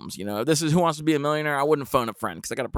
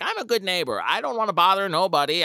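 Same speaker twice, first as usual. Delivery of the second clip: lightly voiced old lady, higher; mildly self-righteous; a hint of the neighbor; 6 semitones higher, 4 dB louder, faster than usual. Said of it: "She's like".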